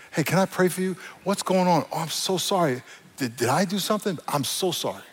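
gentle, softened apology; de-escalate with soft, concerned questions; soft answer. Acted. Believer calming someone who yells, soft answer. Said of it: "a soft answer turns away wrath".

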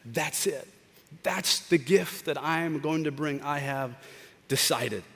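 soft, slow, hushed decision; woman deciding on her gift, hushed; quiet resolve. Acted. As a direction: say it softly, settled in her heart. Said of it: "And this woman said".

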